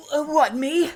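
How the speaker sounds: put-on high, loud flustered teen boy caught out; hollow teen boy voice. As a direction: squeak out an embarrassed denial. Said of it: "that hollow voice".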